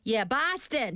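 Boston said the Boston way with the R dropped; Boston accent. Shown as a demonstration. Host showing listeners how Bostonians say Boston. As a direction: drop the R in Boston like a local. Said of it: "보스턴은".

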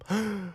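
sharp excited gasp; quick high gasp of delight. Real, not acted. Gasp at listener answers.

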